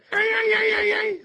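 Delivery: very high, loud squeaky imitation. Teacher imitating untrained speakers, high squeaky voice. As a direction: squeak it out far above your own voice. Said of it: "They got a high, squeaky voice".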